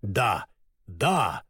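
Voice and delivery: understated low-pitched agreement; fervent. A narrator's reading. Woodman agreeing to save Ellie, fervent. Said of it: "горячо подхватил железный дровосек".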